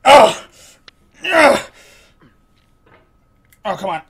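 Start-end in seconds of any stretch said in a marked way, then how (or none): 0.1-1.6 high, forceful "ugh"; loud frustrated grunts. Real, not acted. Player straining up the mountain, grunting loudly. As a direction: grunt loudly, higher the second time.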